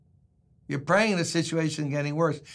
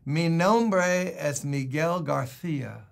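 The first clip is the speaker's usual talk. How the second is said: slow lisped Castilian Spanish, Garcia with a th; imitating Spain Spanish; slower than usual. A preacher mimics his Spanish tape in a Castilian accent. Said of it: "this guy is talking on the tape".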